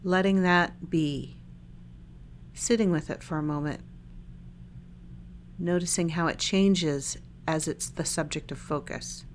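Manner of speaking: calm body-scan guidance with long silences between phrases; unhurried, even meditation-guide voice; guided meditation. Real, not acted. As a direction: lead listeners gently through a meditation, leaving lots of space.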